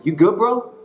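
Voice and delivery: laid-back rising "You good, bro?"; casual bro greeting. Pretend. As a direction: toss it off like greeting a buddy. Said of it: "literally translates as".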